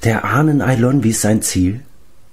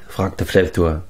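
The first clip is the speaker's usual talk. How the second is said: ordinary delivery, nothing marked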